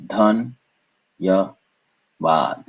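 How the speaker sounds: "Dhan, ya, vad" as three separate beats; syllable by syllable. Shown as a demonstration. Teacher repeating for learners, "Dhan, ya, vad" syllable by syllable. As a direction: pause between "Dhan", "ya" and "vad". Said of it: "three syllables once again".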